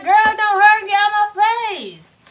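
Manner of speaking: high loud brush-off; loud annoyed retort. Retold or imitated. Patient telling aunties to leave, loud and high. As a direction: shoo them off loudly.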